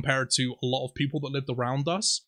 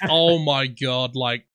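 usual speaking voice

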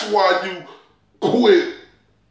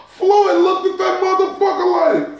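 excited retelling; 12 semitones higher, 4 dB louder than usual. Real, not acted.

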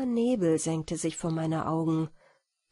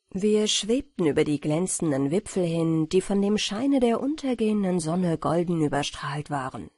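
usual speaking voice

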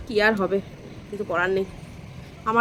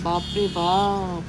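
amazed; 4 semitones lower, 4 dB quieter, much slower than usual. Real, not acted. Reacting to the wind and clouds.

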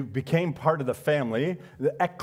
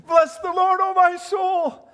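high, loud laughing praise; joyful outburst; 14 semitones higher, 8 dB louder, slower than usual. Real, not acted. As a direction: burst out in delighted praise. Laughs then exclaims praise.

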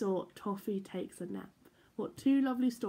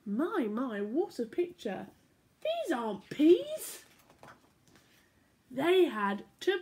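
bright, lilting storybook voice; reading to children; 3 semitones higher, slower than usual. A narrator's reading. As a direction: read it like bedtime story time for little ones. Reading picture book aloud.

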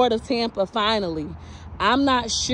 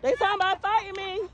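high-pitched, play-acted plea; mock cry for help; 9 semitones higher than usual. Vlogger joking that police want to fight her, mock alarm. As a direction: plead in a high voice, pretending to be attacked.

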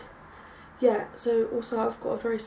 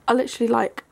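ordinary talk, nothing marked

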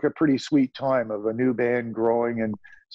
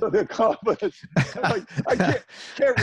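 laughing; 9 semitones higher than usual. Real, not acted. Laughing at producer's bravado.

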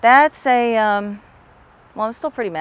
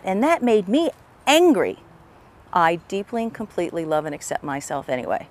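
tapping setup phrase. Shown as a demonstration. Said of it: "So here we go".

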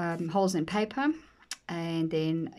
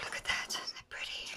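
hushed whisper; 9 dB quieter than usual. Real, not acted. Hushed admiration of a page.

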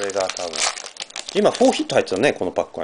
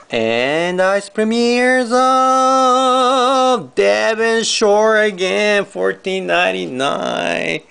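high, very drawn-out announcer call; hype announcer; 5 semitones higher, much slower than usual. Real, not acted. Card breaker revealing a numbered card, hype announcer. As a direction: hold the words long and high like a ring announcer.

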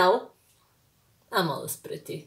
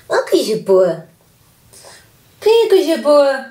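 fond cooing; 2 semitones higher, 10 dB louder than usual. Real, not acted. Cooing over a baby.

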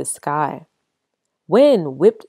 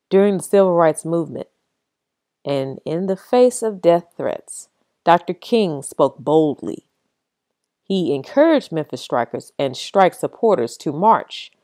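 usual speaking voice

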